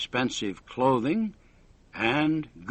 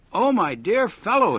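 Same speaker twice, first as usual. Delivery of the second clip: excited; 7 semitones higher, 6 dB louder than usual. A narrator's reading. Excited visitor bursts into lab.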